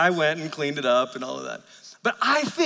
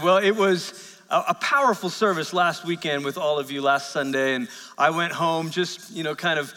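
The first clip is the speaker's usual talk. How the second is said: usual speaking voice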